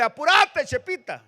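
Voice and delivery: high, loud call to a straggler; urging shout. Acted. Preacher voices ants urging on their struggling companion, high urgent shout. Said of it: "gritándole".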